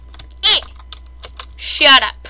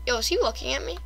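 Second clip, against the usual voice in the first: ordinary delivery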